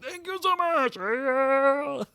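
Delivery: hammed-up delighted employee; playful high-voiced thanks; grateful squeal. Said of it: "They're like".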